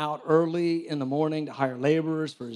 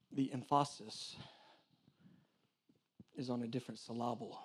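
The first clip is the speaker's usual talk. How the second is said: deadpan, misplaced stress on emphasis; wrong syllable joke; 3 semitones lower, 13 dB quieter than usual. Shown as a demonstration. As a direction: stress wrongly placed in emphasis, low and quiet. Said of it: "why I just said that".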